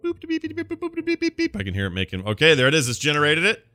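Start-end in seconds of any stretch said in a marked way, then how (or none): 0.0-1.5 high beeps like a machine working; computer beeping noises. Pretend. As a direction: beep like a computer crunching data. Quiz generator making computer noises.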